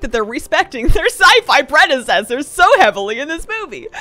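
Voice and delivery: overblown naive praise. Retold or imitated.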